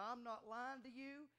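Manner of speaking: normal talking voice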